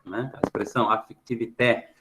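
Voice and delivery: ordinary talk, nothing marked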